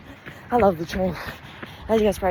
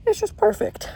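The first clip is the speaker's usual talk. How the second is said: gushing; 3 dB louder than usual. Real, not acted. Gushing about distance.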